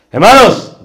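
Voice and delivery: shouted high and loud Hermanos; too loud. Shown as a demonstration. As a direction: blast the opening far too loud. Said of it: "demasiado alto". (demasiado alto, gritando)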